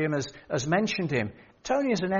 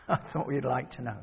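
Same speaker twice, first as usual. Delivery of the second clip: amused murmur; softly laughing; 4 semitones lower, 6 dB quieter than usual. Real, not acted. Preacher repeats the line to the congregation, laughing softly. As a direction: say it again through a soft chuckle, quieter. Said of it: "laughs, I thought you'd like to know".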